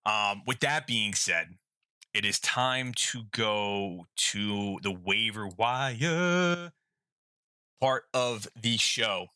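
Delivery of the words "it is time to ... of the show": segment announcer. Acted.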